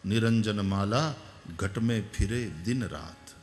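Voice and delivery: measured poem reading of a saint's words; slow devotional recitation. Acted. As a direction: recite the verse slowly and low, like a hymn. Recites Brahmanand verse slowly.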